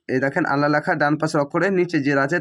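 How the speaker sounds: usual speaking voice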